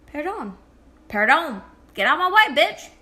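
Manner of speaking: Perdón said loud and curt, then a yelled insult; rude perdón. Shown as a demonstration. Pushing through a crowd, a loud rude Perdón. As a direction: shove past with a loud Perdón. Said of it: "how to say excuse me".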